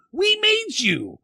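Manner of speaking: high, drawn-out mock parental cry; scolding parent. Parent laying down curfew to the kids, mock scolding. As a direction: play an indignant parent, stretching each word. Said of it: "I wonder if there's parents out there".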